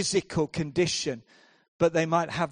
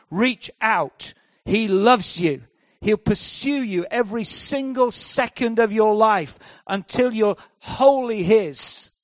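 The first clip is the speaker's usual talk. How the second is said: loud, rising altar-call appeal; fervent preaching; 3 semitones higher, 7 dB louder than usual. Acted. Preacher urging the congregation to reach out, fervent. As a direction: lift the room with the call.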